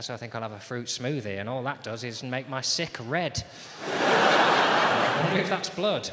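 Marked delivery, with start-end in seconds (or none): 3.9-6.1 high, slow, loud wondering; mock alarm. Alarm at red vomit.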